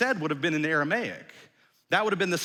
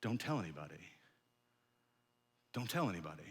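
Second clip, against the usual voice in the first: low, hushed instruction; quiet warning; 9 semitones lower, 11 dB quieter, faster than usual. Acted. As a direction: keep it secret, softly and firmly. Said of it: "Jesus says".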